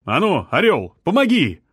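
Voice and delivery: high, loud call to a lad. A narrator's reading. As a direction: shout across the yard for help. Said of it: "крикнул".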